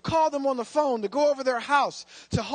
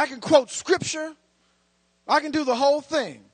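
louder, higher, driving preacher's build-up; preaching at full force; 3 semitones higher, 6 dB louder than usual. Acted. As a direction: whip the room up, voice climbing.

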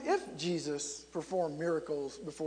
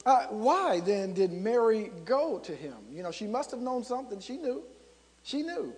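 normal talking voice

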